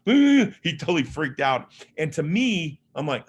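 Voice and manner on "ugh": high, loud groan of disgust. Retold or imitated. Friend sees the naked mom, loud disgusted groan. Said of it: "my friend's like".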